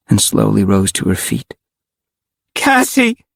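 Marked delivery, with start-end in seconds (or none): 2.6-3.2 high cry of a name; cried out. A narrator's reading. Rachel calls to her lost friend, crying out. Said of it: "Rachel cried".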